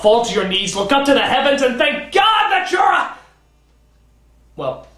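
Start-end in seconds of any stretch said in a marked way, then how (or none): 0.0-3.2 high, building cry of thanks to heaven; mock-rapturous shout. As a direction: shout it skyward, stretched out and far higher. Mock-rapturous hyperbole, breaking off.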